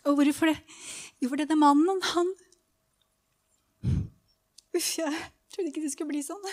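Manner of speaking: real choked-up emotion, long halting pauses; touched, breaking off mid-thought; moved. Real, not acted. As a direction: struggle to keep composure while speaking. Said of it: "Jeg blir litt berørt".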